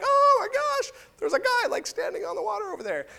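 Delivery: very high-pitched comic alarm; mock freaked-out. Disciples spot a man on the water, mock freak-out. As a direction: squeal it in hammed-up panic. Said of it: "freaked out".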